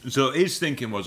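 ordinary delivery

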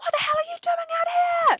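high, loud shrill telling-off; posh friend scolding the runaway girl. Retold or imitated. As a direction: screech at her like an outraged friend. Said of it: "one of them is like".

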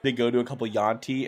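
ordinary delivery

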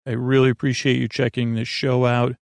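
normal talking voice

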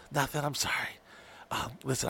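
very soft, retold apology; subdued apology. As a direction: imitate her apologizing under her breath. Voices her subdued apology.

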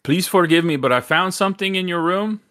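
high-pitched, wobbly attempt at Jamaican; bad Jamaican accent. Manager apologizing to a guest, bad Jamaican accent. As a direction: plead politely in a botched Jamaican accent. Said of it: "This is a horrible Jamaican accent".

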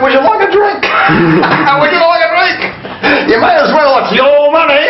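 high, laughing fake hospitality; mock generous. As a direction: offer drinks with gleeful mockery.

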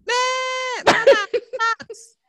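high whiny wail, imitating her son crying; crying child. Mother mimics her son crying over his socks, high wailing sob. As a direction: sob out mama like a toddler in a meltdown.

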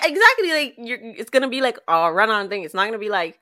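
usual speaking voice

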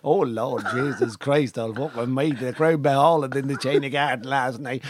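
broad, drawling Navan accent; comic, laid-on Navan accent. Shown as a demonstration. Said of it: "When I get to Navan after two days".

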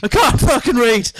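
dad raging in the cinema; angry yell. Retold or imitated. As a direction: scream it, outraged at subtitles. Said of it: "it's him with his kids going".

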